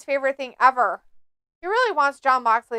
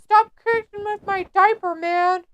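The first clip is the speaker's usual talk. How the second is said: a higher whiny complaint, lightly voiced; high whiny wrestler; 6 semitones higher than usual. Wrestler whining at his opponent, lightly voiced and high. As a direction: whine like a baby.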